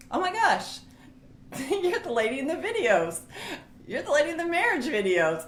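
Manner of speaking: high-pitched imitation of a starstruck fan; excited recognition. Wife acts out woman recognizing her at salon, excited. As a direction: exclaim high, with a laugh breaking in.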